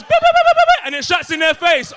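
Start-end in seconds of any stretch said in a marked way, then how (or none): 0.0-0.8 very high, loud beeping; imitated door beep. Imitating the train door closing alarm, high beeping. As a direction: mimic the train door warning beeps. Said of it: "You know when it goes".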